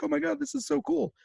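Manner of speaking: high, squealing mock delight; gushing kid. New kids discovering streaming, high gushing delight. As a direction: gush like an awestruck kid. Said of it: "new kids who are like".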